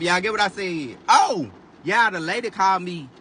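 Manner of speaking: ordinary talk, nothing marked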